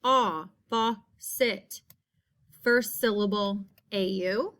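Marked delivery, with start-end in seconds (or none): 0.0-1.8 aw, faucet stretched very slowly; slow sounded-out faucet. Shown as a demonstration.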